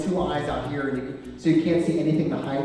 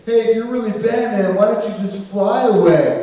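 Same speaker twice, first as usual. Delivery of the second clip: put-on drunk heckler; 6 semitones higher, 7 dB louder than usual.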